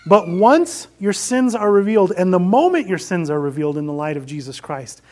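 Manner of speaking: normal talking voice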